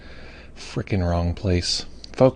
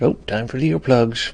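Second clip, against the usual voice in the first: a hint of old folks, pitched higher; lightly voiced old people; 6 semitones higher, 3 dB louder than usual. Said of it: "we feel like old people".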